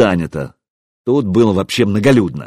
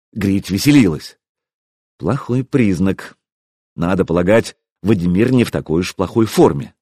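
ordinary delivery, nothing marked

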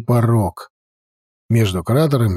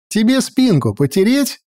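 yelled joke; 8 semitones higher than usual. Acted. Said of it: "заорал Пончик".

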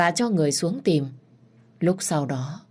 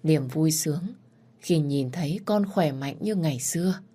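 plain reading voice

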